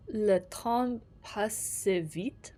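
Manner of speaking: very slow, unsure attempt at French; halting amateur French. Real, not acted. Player reading a French phrase, halting French pronunciation. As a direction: pick through the French word by word. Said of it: "I don't fucking know French".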